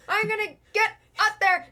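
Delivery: straining effort. Acted. Rat struggling up tree.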